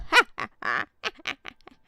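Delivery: high put-on mad cackle; crazy cackle. Shown as a demonstration. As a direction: cackle like a crazy old character. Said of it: "crazy voice, like a".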